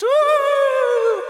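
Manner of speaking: high spooky ghost wail; ghostly woo. Acted. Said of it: "Did I say that I was a ghost?".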